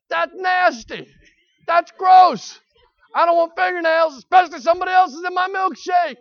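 high, loud cries of revulsion; disgusted shouting. Retold or imitated. Preacher telling congregation about the fingernail, shouting in disgust. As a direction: shout it like the taste is still there.

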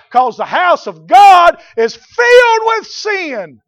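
high, loud, drawn-out pulpit shout; fiery preacher at a sustained high shout; full-force preaching. Real, not acted. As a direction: thunder it out to the congregation.

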